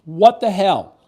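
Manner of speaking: loud high What the hell; exasperated outburst. Real, not acted. Host venting at the viewers, loud outrage. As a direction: throw it out in outrage. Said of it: "let me do it again for emphasis".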